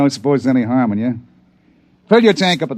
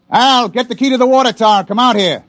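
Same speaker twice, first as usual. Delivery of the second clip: calling out; 10 semitones higher than usual. Acted. Father calls his son out from the yard, high carrying call. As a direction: shout it over to the house.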